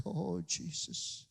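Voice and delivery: tender worship. Pretend. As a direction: linger over each word. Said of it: "sit down in His presence and say".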